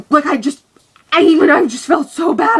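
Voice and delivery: loud, strained wail of regret; distressed outburst. Retold or imitated. Telling how guilty they felt, loud and distressed. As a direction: cry it out, mildly hammed-up. Reliving panic after friend's gasp.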